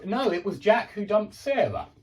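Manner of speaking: "Jack" hit hard and loud; stress on Jack. Shown as a demonstration. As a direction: correct them firmly, punching "Jack". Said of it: "You put the emphasis right there".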